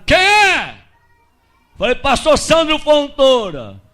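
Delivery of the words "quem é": high-pitched "who's there" holler; sudden high shouted reply; retold shout from inside. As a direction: imitate someone yelling from indoors. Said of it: "de dentro do barraco gritaram".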